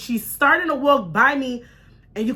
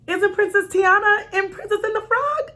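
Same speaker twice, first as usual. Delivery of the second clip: higher, nervous, polite question; 8 semitones higher than usual. Retold or imitated. Challenging a stranger mom politely, nervous and high-pitched. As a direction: keep it high and careful, trying not to be rude. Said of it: "my tone was a little high 'cause I was nervous".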